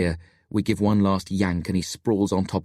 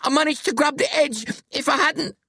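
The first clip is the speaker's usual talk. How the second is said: high, strained, frightened cries; panicked, near tears. A narrator's reading. Billy tells how the floor gave way, panicking. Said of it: "He starts to cry".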